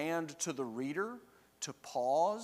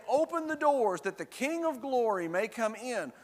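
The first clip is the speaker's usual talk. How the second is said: raised, higher-pitched call for entry; bold demand; 7 semitones higher, 4 dB louder than usual. Acted. Arriving company tells the sentries to open up, demanding. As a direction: proclaim it like a newcomer demanding entrance. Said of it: "you come in here and you just say".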